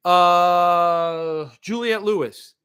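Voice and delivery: ordinary talk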